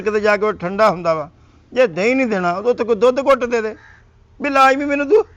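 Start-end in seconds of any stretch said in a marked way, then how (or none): 1.7-5.2 self-pitying whine. Acted. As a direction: whine like you're being starved to death. Sick husband whining at wife. (ਤਰਸਯੋਗ ਉੱਚੀ ਰੋਂਦੀ ਆਵਾਜ਼)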